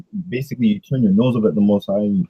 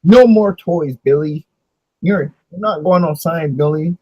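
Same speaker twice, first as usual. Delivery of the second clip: higher, lightly hammed-up scolding parent; mock scolding parent; 5 semitones higher than usual. Parent punishing Billy, in a lightly higher mock scolding voice. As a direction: nag little Billy like a toothless parent.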